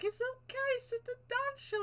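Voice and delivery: high, soft soothing mum voice; a gentle mother calming her upset child; imitating mum. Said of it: "my mum was just there like".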